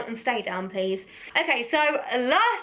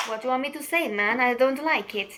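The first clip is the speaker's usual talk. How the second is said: lightly put-on, muddled Italian accent; attempted Italian accent. Vlogger joking about a lipstick she dislikes, attempted Italian accent. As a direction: have a wobbly go at an Italian accent. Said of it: "what accent was that".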